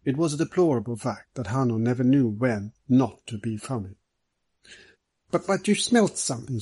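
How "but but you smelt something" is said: higher, reproachful stammer. A narrator's reading. Middleton reproaching Hanno for hiding what he smelt, reproachful. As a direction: chide him for holding back. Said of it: "said Mister Middleton reproachfully".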